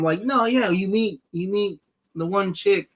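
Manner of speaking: usual speaking voice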